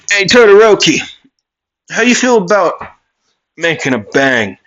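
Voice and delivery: high, loud hail to a rival; calling out. Acted. Character inviting Todoroki to fight, called out.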